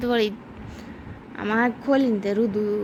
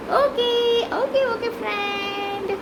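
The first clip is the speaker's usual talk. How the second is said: squeaky okay; 8 semitones higher, slower than usual. Pretend. Playful aside to viewers.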